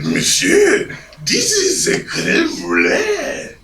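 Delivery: very high, drawn-out French waiter; pompous French accent. Retold or imitated. French waiter defending the crème brulee, pompous accent. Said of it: "in his pompous accent".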